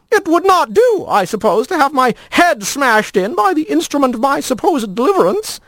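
very high, formal and wordy; a fussy lizard fretting; prim lizard voice. A narrator's reading. Lizard character lines.

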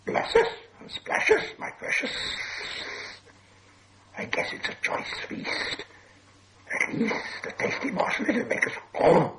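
Gollum's sibilant, voiceless whisper; hissed whisper. A narrator's reading. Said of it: "whispered and hissed".

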